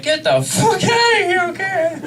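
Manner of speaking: very high, slow frantic yell; frantic shout. Retold or imitated. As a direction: shoo them out in a panic. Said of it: "And I'm like".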